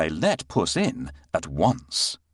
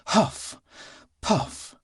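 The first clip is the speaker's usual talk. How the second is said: panting; 11 semitones higher than usual. A narrator's reading. Narrator mimes panting.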